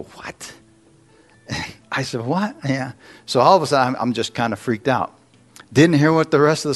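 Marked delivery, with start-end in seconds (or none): 0.0-0.5 whispered, stunned "what?"; retold shock, voice nearly gone. Man learning he was out twenty minutes, stunned whisper. Retelling shock at friend's news. 2.2-2.5 stunned. Retold or imitated. Repeats his shocked reaction.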